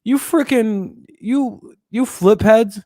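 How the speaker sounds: high, squeaky mock outburst; mock anger. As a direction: imitate feeble anger in a high, drawn-out voice. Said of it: "pay attention to his anger".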